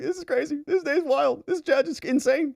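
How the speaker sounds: high-pitched, put-on laughing aside; imitated laughing disbelief. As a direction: play a lawyer cracking up at the absurdity. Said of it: "And he's laughing".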